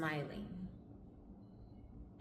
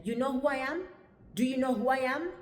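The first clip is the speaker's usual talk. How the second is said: higher, rising, louder on the repeat; indignant challenge; 7 semitones higher, 7 dB louder than usual. Retold or imitated. Dad confronts the visa clerk, indignant challenge. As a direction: pull rank with an imitated challenge.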